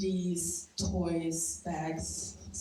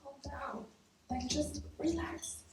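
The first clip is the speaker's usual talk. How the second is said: retold calm; 9 semitones higher, 6 dB quieter than usual. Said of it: "tell my mom".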